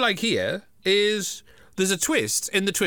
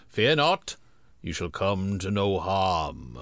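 low, slow ghostly voice; slow spooky ghost reassurance; ghostly figure; 9 semitones lower, slower than usual. Retold or imitated. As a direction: intone it like a looming spirit. Ghostly figure speaks to boy.

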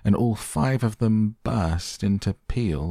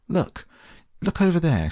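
high, loud excited cry; excited scream; 12 semitones higher, 3 dB louder than usual. A narrator's reading. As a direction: shriek it across the river. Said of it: "she screamed".

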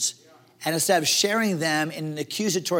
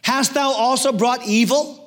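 crying out to God; 6 semitones higher, 3 dB louder, slower than usual. A narrator's reading. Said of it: "he cried unto the Lord".

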